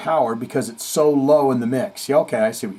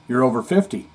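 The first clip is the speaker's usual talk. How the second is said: usual speaking voice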